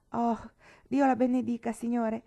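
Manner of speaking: put-on high, loud old woman crying out her thanks; grateful cry. A narrator's reading. Old Nancy thanking Mr Weston for her cat, loud grateful cry. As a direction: cry out in grateful joy, near tears. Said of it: "gridò la vecchia riconoscente".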